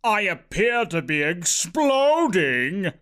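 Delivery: slow, comic dismay; little Bernard. A narrator's reading. Little Bernard announces his doom, comically dismayed. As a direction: stretch it out in mock alarm. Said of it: "the little Bernard said".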